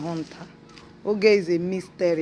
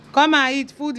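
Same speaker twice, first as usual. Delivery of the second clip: higher, louder call to eat; 8 semitones higher, 6 dB louder than usual. Real, not acted. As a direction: raise the call to reach the dog. Calling the dog to eat.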